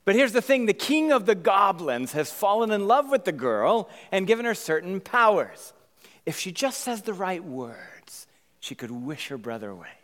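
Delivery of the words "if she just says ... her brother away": hushed hint. Acted. Conspiratorial storytelling hush.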